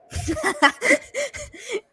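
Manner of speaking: high burst of laughter; real laugh. Real, not acted. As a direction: laugh at your own story. Laughs at own story.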